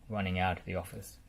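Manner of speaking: ordinary delivery, nothing marked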